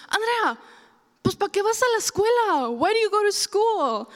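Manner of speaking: high, loud scolding in Spanish then English; exasperated mom. Retold or imitated. Mom scolding her daughter for not understanding, high and loud. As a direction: scold like a fed-up mother.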